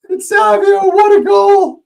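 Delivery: high, loud goal yell; excited shout. Real, not acted. Streamer celebrating a wonder goal, ecstatic shout. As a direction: scream it like the ball just hit the net.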